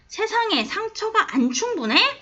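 high-pitched rhetorical challenge, retold; ironic question. The woman rebukes the reader over hurt in the world, ironic. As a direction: imitate someone asking with pointed irony. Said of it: "그러니까 반어법이야".

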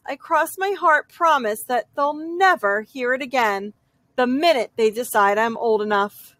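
ordinary delivery

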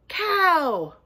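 ordinary delivery, nothing marked

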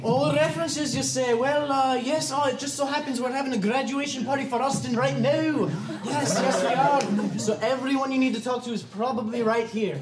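hammed-up Scottish accent; put-on Scotsman bluffing on the phone; comic Scottish voice, stalling as he improvises; [name]. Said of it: "[name] might have put a big fat Scottish".

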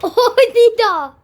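loud, slow shout through laughter; laughing shout. Real, not acted. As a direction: cry out her name while laughing.

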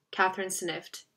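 usual speaking voice

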